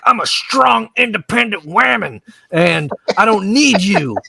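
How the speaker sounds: mock self-assertion, starting high; mock defiant declaration. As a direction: play a hammed-up independent woman. Retold wife declaring independence.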